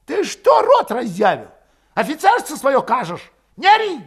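high, loud, defiant outburst; angry yell. A narrator's reading. Valet spitting defiance at Grigory's officer airs, yelling. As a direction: yell back in a high pitch. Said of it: "выкрикнул Валет".

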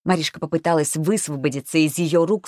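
usual speaking voice

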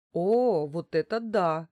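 a burst of admiration; admiring. A narrator's reading. Woman reacting to the giantess's sporting title, admiring. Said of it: "выразила я своё восхищение".

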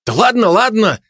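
high, hasty give-in; startled and placating; frightened. A narrator's reading. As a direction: back down in alarm, voice jumping up. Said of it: "испугался Кухарик".

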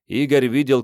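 ordinary delivery, nothing marked

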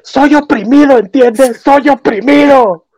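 high-pitched hammed-up protest; drawn-out mock cry of grievance. Mimicking the show's message, high mock protest. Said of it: "es así de".

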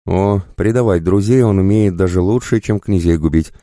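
mock admiration to stir up anger; goading. A narrator's reading. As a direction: needle him about his betrayals. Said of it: "подначивал Косой".

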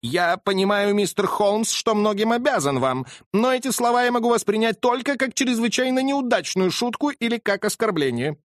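high, offended and indignant; flared up. Acted. Colonel takes offence at Holmes, high and indignant. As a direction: bristle at the insult. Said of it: "Полковник вспыхнул".

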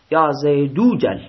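یا زیدو جل as "ya Zay-DOO-jal", drawn out; linked u reading. Shown as a demonstration. Teacher repeating the example for students, slow long oo linking the words. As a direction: run زیدو into جل with a long oo, no hamza.

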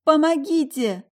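high, slow desperate call; cry for help. A narrator's reading. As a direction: scream for help. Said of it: "сдавленный крик".

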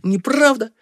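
high-pitched, insistent denial; protesting. A narrator's reading. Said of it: "снова запротестовал Бакае". (отчаянный протест)

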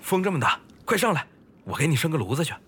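high, loud call against the wind; host shouting welcome over a windy river. Real, not acted. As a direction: urge him aboard over the gusts.

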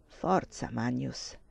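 low, soft ironic urging; a quiet, sadly ironic prod; sad irony. A narrator's reading. Dying Lelia urges Magnus the priest on, with sad irony. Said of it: "disse lei con triste ironia".

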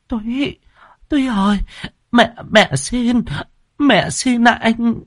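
high, slow, frail pleading; old mother pleading. A narrator's reading. Old mother begs her son to stop, high and slow. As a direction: beg him feebly to stop. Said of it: "vội can ngăn".

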